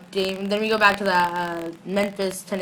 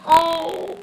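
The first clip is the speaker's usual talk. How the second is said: high, drawn-out swoon; smitten aww; 8 semitones higher than usual. Retold or imitated. Vlogger reacting to her kissing him, smitten aww. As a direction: melt into a long, high coo.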